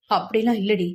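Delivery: usual speaking voice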